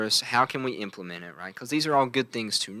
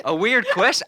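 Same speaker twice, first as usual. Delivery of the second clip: higher, louder hometown Logan accent; 11 semitones higher, 5 dB louder than usual. Shown as a demonstration. Defending the hometown accent to co-host, in high, loud Logan accent. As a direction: show off your native country accent. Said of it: "I can do it because that's what I'm supposed to sound like".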